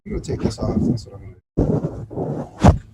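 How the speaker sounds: cut-off muffled voice; barely heard through the mic. Real, not acted. Mic cutting out.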